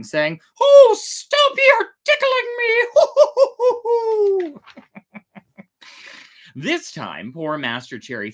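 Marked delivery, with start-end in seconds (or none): 0.6-4.6 very high, squeaky giggling voice, slowed; a ticklish little voice shrieking with laughter; tiny voice laughing. Acted. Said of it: "the same little voice laughing". 4.6-5.6 breathy giggle. Acted. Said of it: "the same little voice laughing".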